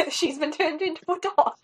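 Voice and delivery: laughing. Real, not acted. Laughing over the polymorph.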